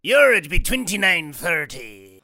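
pirate voice. Pretend. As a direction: play a cartoon pirate. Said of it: "Or a pirate voice".

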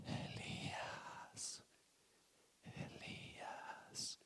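slow, breathy whisper of the name. Acted. God's still small voice calling Elijah, whispered. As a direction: call him softly like a faint breeze. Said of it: "un ligero susurro".

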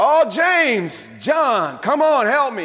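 hammed-up plea to James and John; calling for help. Sinking Peter calling his friends for help, loud and high.

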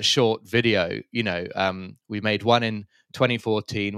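usual speaking voice

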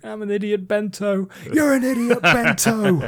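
high, loud mock chef insult; hammed-up chef forcing a confession; Gordon Ramsay impression. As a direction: scold the cook like a TV chef. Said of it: "a Gordon Ramsay voice".

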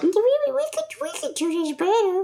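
very high inner-voice nag; very high critic voice nagging from the back of the mind. Retold or imitated. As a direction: play the little voice in your head. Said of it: "So I'm just like".

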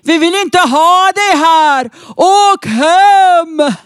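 loud, slow mock shout of young thugs; mock yell. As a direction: bellow it like drunk men under a lamp. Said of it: "unga män som skriker".

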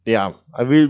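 usual speaking voice

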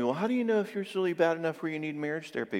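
light imitation of a curious listener; mock asker. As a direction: mimic a worried asker. People asking a question.

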